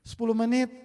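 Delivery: usual speaking voice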